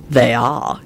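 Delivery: low, sly confirmation; smirking. Acted. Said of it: "he replied with a smirk".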